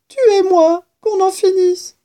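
very high, plaintive surrender; despairing mouse. A narrator's reading. Mouse begging the kitten to finish it, high and despairing. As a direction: give up in a thin, high-pitched plea. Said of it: "répondit la souris dans un souffle".

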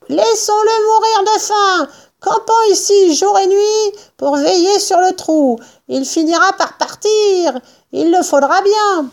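high-pitched scheming character voice; determined farmer. A narrator's reading. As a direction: lay out a siege plan, voice held high. Bean expose son plan. (fermier obstiné, voix aiguë)

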